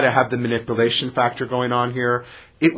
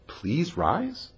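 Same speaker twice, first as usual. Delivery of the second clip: quiet, rising disbelief at the word rise; incredulous question; 2 semitones higher, 8 dB quieter than usual. Shown as a demonstration. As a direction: ask it back as if it can't be right.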